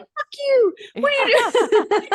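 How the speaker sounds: high laughing shouted insult; cartoon wiener dog picking a fight; trash-talking dog voice. Neighbors' wiener dogs barking, high trash-talk voice. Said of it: "they're all like".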